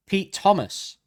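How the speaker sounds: name called out high and loud, falling at the end; big game-show announcement; announcer-style reveal. Acted. Quiz host reveals the drummer.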